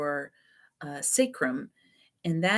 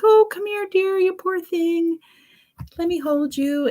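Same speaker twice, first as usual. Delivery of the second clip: comforting parent; 13 semitones higher, 5 dB louder than usual. Retold or imitated. Parent comforting a child who fell on the playground, high cooing voice. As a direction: coo over a hurt child like a doting parent. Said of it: "their parent is like".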